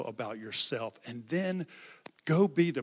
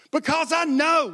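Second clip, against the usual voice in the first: put-on much higher, louder wailing boy's cry; imitating bawling; 13 semitones higher, 11 dB louder than usual. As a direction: reenact the sobbing boy, wailing high. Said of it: "he was bawling".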